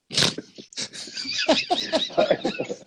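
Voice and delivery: high cackling burst of laughter; big laugh. Real, not acted. Friend cracking up at a tease, high cackle. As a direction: let out a loud real laugh at the joke.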